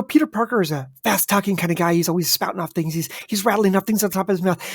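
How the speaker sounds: rapid, excitable rattle; words spouting out at speed; fast-talking. Shown as a demonstration. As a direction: rattle it off quickly. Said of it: "The speed at which he's talking".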